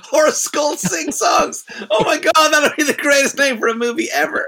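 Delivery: loud, high-pitched, thrilled outburst; delighted exclamation. Real, not acted. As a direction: exclaim it high, relishing the title. Delighted reaction to the imagined film title.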